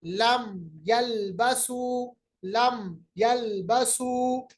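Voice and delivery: slow, drawn recitation with लम closed at the lips, no ghunna; tajweed demo, no nasal hold; clear meem. Shown as a demonstration. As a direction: say लम यलबसु with the meem stopped cleanly. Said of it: "आवाज़ को मखरज में बंद करके आगे चले गए".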